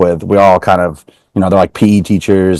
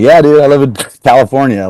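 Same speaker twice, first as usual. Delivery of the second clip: high, loud cocky reply; playful brag; 6 semitones higher, 3 dB louder than usual. Retold or imitated. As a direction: show off jokingly. Joking reply to old friends.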